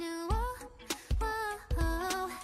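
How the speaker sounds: ordinary talk, nothing marked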